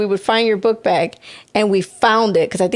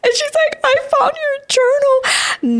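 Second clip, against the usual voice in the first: excited squealing; 14 semitones higher than usual. Retold or imitated. Excited phone call about journal.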